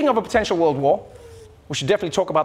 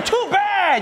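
mocking; 12 semitones higher than usual. Mocking retort.

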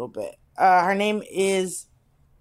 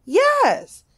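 high squeaky yes; 4 dB louder than usual. Retold or imitated. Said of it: "And I was like".

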